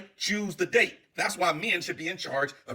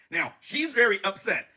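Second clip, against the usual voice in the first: usual speaking voice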